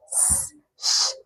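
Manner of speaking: soft hiss, then a hush; two bare consonant sounds; isolated s and sh. Shown as a demonstration. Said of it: "the predominant sound there is sh".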